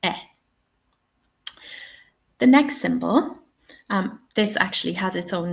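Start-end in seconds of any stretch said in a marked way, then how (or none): 0.0-0.2 eh said short, as in "ten". Shown as a demonstration. Teacher models the undotted vowel, short eh. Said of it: "so it's nice and short".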